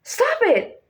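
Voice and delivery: urgent high STOP, steep fall to IT; rise-fall intonation. Shown as a demonstration. Teacher showing how to use it, STOP high, IT dropping. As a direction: STOP shoots up high, then IT drops sharply. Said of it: "go higher fast then drop sharply".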